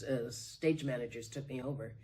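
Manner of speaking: usual speaking voice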